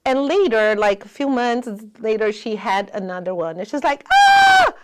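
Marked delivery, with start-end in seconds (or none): 4.1-4.7 high, loud mock cry of a little girl; imitating the girl's frightened cry; child's yelp. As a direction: squeal like a startled child. Girl cries out at cut.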